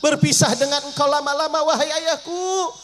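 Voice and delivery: high, crying daughter's plea, slowing at the end; tearful plea. Acted. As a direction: beg the father not to leave, near tears. Fatimah pleads to her father.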